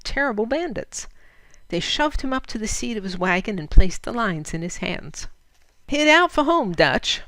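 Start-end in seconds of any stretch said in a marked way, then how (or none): 5.9-7.2 loud, high barked order; commanding. Acted. Bandit Hondo Bill sends Fritz home, loud and commanding. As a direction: order him off at once. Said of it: "said Hondo Bill's voice commandingly".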